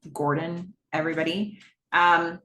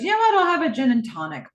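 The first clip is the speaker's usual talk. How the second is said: high, loud burst easing down; hammed-up decisive order, starting way up high; impulsive acted order; 9 semitones higher, 5 dB louder, faster than usual. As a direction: imitate suddenly deciding on a drink. Acted drink order by Donna.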